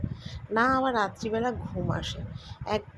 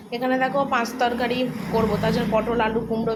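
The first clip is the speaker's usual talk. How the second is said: louder, upbeat listing of vegetables; louder showing off; 3 semitones higher, 7 dB louder than usual. Real, not acted. Vlogger loudly shows her vegetables to viewers, upbeat. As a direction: call out each vegetable brightly to the viewers. Showing cooked dishes to camera.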